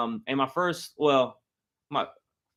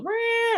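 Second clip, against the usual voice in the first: much higher mechanical meow; launch sound imitation. Mimicking the Hulk coaster's launch sound, high mechanical meow. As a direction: make the ride's countdown noise.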